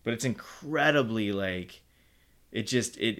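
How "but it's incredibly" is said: ordinary talk, nothing marked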